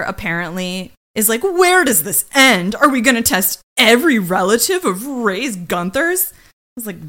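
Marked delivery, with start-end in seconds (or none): 1.4-6.3 loud, hammed-up courtroom protest; mock dramatic outrage. Host imitating the lawyer objecting to more tests, mock dramatic. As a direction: make a big drama of the objection.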